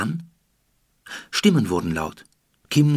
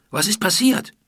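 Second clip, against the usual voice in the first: raised, rising urgent question; calling out; 11 semitones higher, 4 dB louder than usual. Acted. Julian calls out the question to Iti, worried. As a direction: call the question out loud and urgent. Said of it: "rief Julian".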